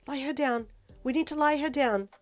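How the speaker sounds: high, loud urgent call. A narrator's reading.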